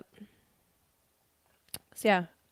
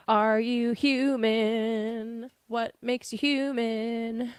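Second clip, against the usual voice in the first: slow dramatic; 3 semitones higher, much slower than usual. Acted.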